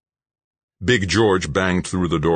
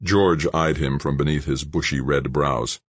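usual speaking voice

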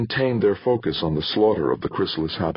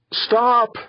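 loud, high-pitched scream; 5 dB louder than usual. A narrator's reading. Comm center begging the attacker, screamed. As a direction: scream it out as a desperate plea. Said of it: "screamed the voice".

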